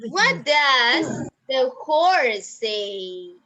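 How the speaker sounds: very slow, word by word; slow question. Shown as a demonstration. Teacher asking children about the horse, very slowly. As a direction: draw each word out for young learners.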